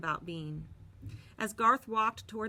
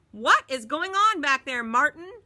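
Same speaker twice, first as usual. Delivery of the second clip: loud, higher stern call; driver calling out; 8 semitones higher, 11 dB louder than usual. A narrator's reading. Bus driver shouts back to Martin. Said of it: "Miss Victoria called out".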